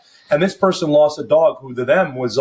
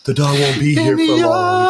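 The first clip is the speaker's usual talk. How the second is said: hammed-up sorrowful narration voice; sad video narrator; 13 semitones higher than usual. Mocking a sad pet video's tearful voiceover. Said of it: "the really sad TikTok ... they're like".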